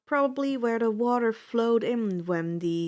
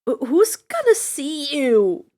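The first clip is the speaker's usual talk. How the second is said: squeaking; 3 semitones higher, 8 dB louder than usual. A narrator's reading. Percy squeaking back at Annabeth, embarrassed. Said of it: "I squeaked".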